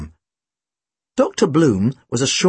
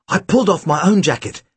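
ordinary delivery

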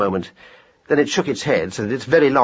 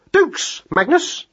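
very high hare voice; explaining hare; much slower than usual. Acted. Hare explains slang to mouse, pitched high.

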